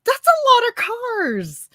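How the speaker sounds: high, loud disbelieving gasp; astonished, drawn-out exclamation; surprised. Real, not acted. Shocked at four or five cars.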